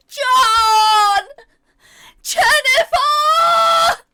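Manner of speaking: high, drawn-out screaming for help; desperate scream. A narrator's reading. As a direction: shriek both names in panic. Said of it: "opting to cry out again".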